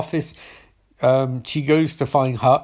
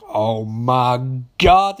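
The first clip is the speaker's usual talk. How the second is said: very slow, drawn-out realisation; mock dread; 2 semitones lower, 3 dB louder, much slower than usual. Mildred hearing of seven murdered nurses, mock-horrified. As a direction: stretch each word with hammed-up weight. Acts Mildred's reaction to massacre.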